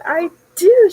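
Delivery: high, loud gushing yes; excited. Retold or imitated. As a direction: gush with delight. Boss asks about raise.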